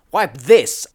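loud, high battle cry; shout. A narrator's reading. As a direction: shout it back at the robot. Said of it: "I shouted".

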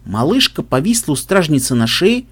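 ordinary delivery, nothing marked

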